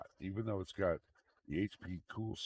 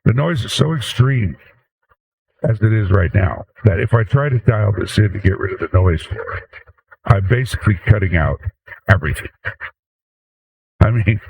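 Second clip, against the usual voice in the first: heavily compressed, echoey mic sound; over-compressed mic; 20 dB louder than usual. Real, not acted. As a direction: voice through a stacked compressor chain with echo. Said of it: "God, it's echoes".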